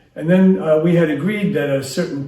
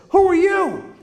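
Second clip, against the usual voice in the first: much higher, louder indignant challenge; a kid squaring up to an adult; cheeky child; 5 dB louder than usual. Retold or imitated. Defiant child challenging the teacher, high and loud. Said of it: "and I love this".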